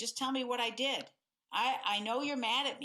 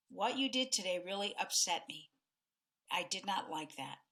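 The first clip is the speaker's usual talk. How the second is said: calm tone; 3 semitones lower than usual. Shown as a demonstration. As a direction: lay it out gently and calmly. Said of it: "both of those tones".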